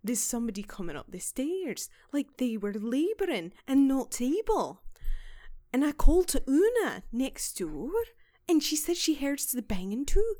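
put-on light Scottish accent, storytelling. Podcaster voicing the housekeeper's account, light Scottish accent. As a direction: roll it out like a Scots housekeeper. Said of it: "I'm just gonna do Scottish 'cause it's easier".

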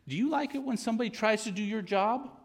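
very high, loud scolding voice; imitating mentor. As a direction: needle him like a sharp old southern lady. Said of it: "and she'd say".

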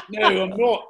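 loud mock British denial; terrible British accent. Imitating a British friend answering a roofer, terrible British accent. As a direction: deny it in a bad British accent. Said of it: "Here's my terrible British accent".

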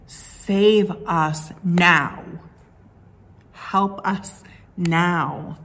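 very slow, drawn-out plea; pleading cry. Acted. Crowd begging Jesus for rescue, slow pleading. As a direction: stretch each word like a desperate prayer. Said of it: "Some people were indeed crying".

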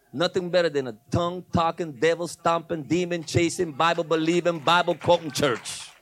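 loud, rhythmic sermon list; emphatic preaching. Real, not acted. Preacher praises a Spirit-filled church, loud and driving. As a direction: punch out each hyphenated phrase, stretching the last.

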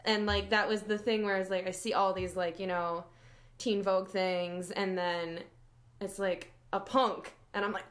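ordinary delivery

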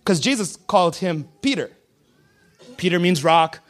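ordinary talk, nothing marked

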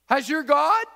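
high, loud desperate shout; anxious king calling. Acted. The king shouting down to Daniel in the den, high and loud. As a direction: yell down into the pit for an answer.

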